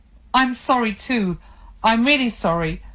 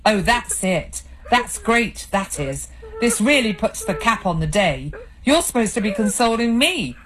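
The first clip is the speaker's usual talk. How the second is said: angry tirade; 5 semitones higher than usual. Acted. Husband berating his crying wife, angry and bitter. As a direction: lay into her, bitter and angry.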